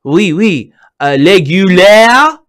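mock French accent, slowed on régulier; French attendant. Host retelling the French gas attendant, mock French accent. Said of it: "the person only speaks French".

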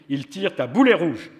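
man proudly telling Alice of cannonballs, loud and high; dramatic exclamation. A narrator's reading. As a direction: announce it with gusto. Man's dramatic remark.

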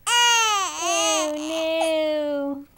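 slow, loud, hammed-up sob; overdone fake cry; mock crying. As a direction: pretend to weep over the sad kitty. Mock crying over sad kitty.

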